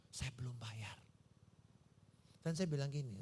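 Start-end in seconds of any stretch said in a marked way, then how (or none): 0.1-1.0 whispered confession. Retold or imitated. Realizing he hadn't paid.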